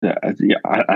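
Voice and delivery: ordinary talk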